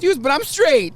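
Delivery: high, loud quoted exclamation; mock protest. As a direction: blurt it loudly in his voice. Quoting passenger's comic protest.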